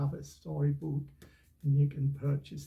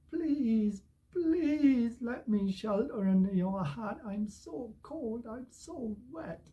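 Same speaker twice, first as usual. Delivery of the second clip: begging; 6 semitones higher, slower than usual. Acted. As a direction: beg as a cold, wet storybook dog. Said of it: "and then they beg".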